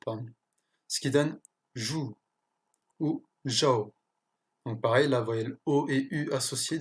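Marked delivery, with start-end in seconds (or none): 1.8-2.2 "jou" with a voiced French j, no D. Shown as a demonstration. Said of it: "se prononce comme un j". 3.5-3.9 "zhao" as "jao", no D; French j gliding into ao in "zhao". Shown as a demonstration. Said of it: "se prononce comme un j".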